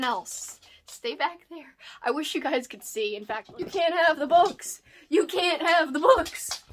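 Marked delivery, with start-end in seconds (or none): 3.6-6.6 higher, louder pet-scolding voice; playful scolding. Real, not acted. Owner keeping dog off the books, playful scolding. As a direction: tell off the dog, pitched up and insistent.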